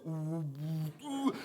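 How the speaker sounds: a dull, muffled "우" heard through water; muffled underwater sound. Shown as a demonstration. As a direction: hum it as if heard from inside the womb. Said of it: "이렇게 들리는 거예요". (물속에서 듣듯 먹먹한 우 소리)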